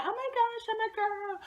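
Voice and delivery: high-pitched mock girly whine; helpless girly girl. Mocking girls who can't do anything, helpless girly. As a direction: squeal it in a put-on, exaggerated girlish voice. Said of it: "you know how some girls, like, they act so".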